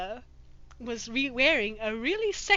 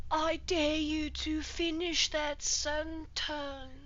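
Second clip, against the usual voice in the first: menacing mutter; 3 semitones higher, 4 dB quieter, slower than usual. Acted. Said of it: "muttered at the top of his lungs".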